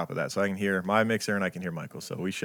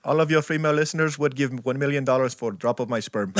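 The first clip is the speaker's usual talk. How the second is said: put-on Indian accent; 5 semitones higher than usual. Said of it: "Been working on my Indian accent".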